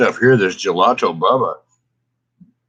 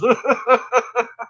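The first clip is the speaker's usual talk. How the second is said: high laugh; 13 semitones higher than usual. Real, not acted. Laughing at her own school-days story, high laugh.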